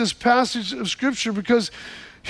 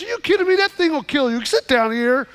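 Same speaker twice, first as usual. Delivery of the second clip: high-pitched disbelief, easing down into an order; acted exasperated grandpa, pitched up; incredulous scolding; 4 semitones higher than usual. Grandpa refusing to let boy ride, incredulous.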